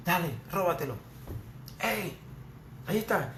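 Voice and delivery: a hint of a sly tempting voice; voice of temptation. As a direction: lure them in gently, like sin calling. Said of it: "te va diciendo".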